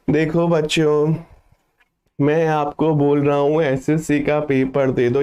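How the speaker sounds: low, lifeless voice of a bored teacher; sluggish dull speaker. Shown as a demonstration. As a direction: sound like someone half asleep. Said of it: "कहीं आप एकदम सुस्त इंसान बन करके तो बात नहीं करते हो".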